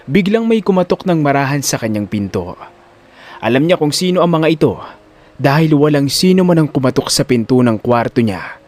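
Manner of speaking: ordinary talk, nothing marked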